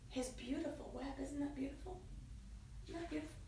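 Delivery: ordinary delivery, nothing marked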